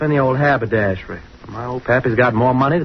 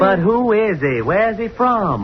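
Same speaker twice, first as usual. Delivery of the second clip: insistent questioning; 6 semitones higher than usual. Acted. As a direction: keep pushing, more indignant every time. Pressing about the newcomer's family.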